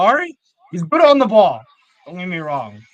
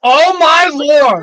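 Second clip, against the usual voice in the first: loud, high, drawn-out exclamation; astonished outburst; 8 semitones higher, 9 dB louder, much slower than usual. Real, not acted. Fan watches Trafford deal with an attack, amazed outburst. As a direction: cry out slowly in amazement. Shocked reaction to live play.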